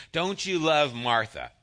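usual speaking voice

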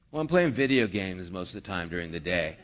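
low, laughing mock reply of a lazy slacker; a slacker caught out, mumbling an excuse; sheepish excuse. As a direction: sheepishly admit it with a chuckle. Mock answer from lazy job-seeker.

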